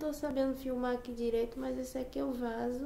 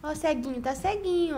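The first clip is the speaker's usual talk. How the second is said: talking to a pet; 5 semitones higher, 10 dB louder, faster than usual. Real, not acted. Cooing at the pet.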